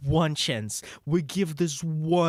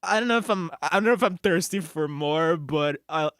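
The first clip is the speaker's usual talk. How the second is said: usual speaking voice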